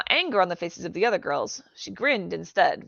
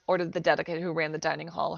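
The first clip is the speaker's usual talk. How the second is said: plain reading voice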